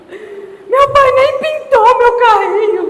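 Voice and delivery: loud, high, drawn-out wail; mock crying child. Father retelling his daughter's tantrum over the unpainted car, mock-crying child. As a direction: imitate a little girl bawling her complaint. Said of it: "começou a chorar".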